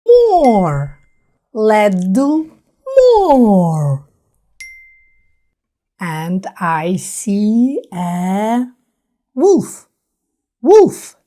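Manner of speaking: normal talking voice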